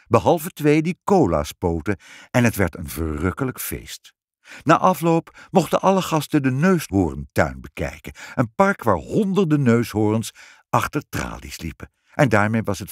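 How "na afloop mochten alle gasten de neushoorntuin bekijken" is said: ordinary delivery, nothing marked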